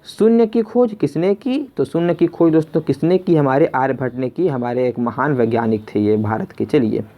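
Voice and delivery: ordinary delivery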